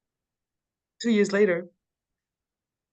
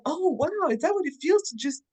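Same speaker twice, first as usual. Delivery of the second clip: high, wide-eyed wonder at feeling safe; amazed disbelief; 7 semitones higher than usual. Retold or imitated. Retelling her amazement at a safe relationship, high and astonished. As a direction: marvel at it like a brand new discovery. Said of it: "It's like".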